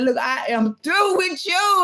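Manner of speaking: a mock stern God, high and drawn out; God fed up. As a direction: stretch it out as an exasperated God. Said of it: "God said".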